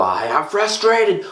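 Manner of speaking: high, slow mock upset "I am livid"; hammed-up angry declaration; acting upset. Shown as a demonstration. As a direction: act out a charged complaint, drawn out. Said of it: "we use certain words like".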